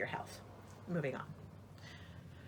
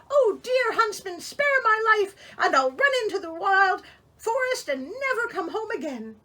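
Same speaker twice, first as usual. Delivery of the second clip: put-on pleading girl; 11 semitones higher, 8 dB louder than usual. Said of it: "she began to weep and said".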